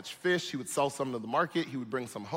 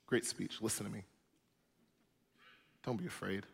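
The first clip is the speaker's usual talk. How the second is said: soft, lower reassuring voice; calm a frightened friend gently; tender Jesus; 5 semitones lower, 8 dB quieter than usual. Retold or imitated. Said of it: "he says".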